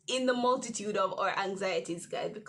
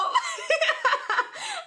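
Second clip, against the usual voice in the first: high crying laugh; 7 dB louder than usual. Real, not acted. After realising illegal.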